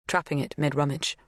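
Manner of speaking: usual speaking voice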